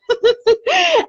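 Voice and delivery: high-pitched giggle; real laugh. Real, not acted. Laughs after nice remark.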